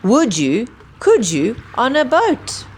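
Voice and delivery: plain reading voice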